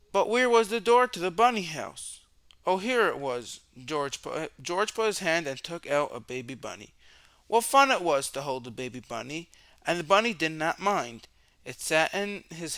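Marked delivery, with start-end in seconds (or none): none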